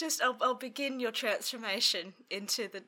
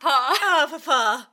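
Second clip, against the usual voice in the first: mock wail; 6 semitones higher, 15 dB louder, much slower than usual. Echoes mock lament louder.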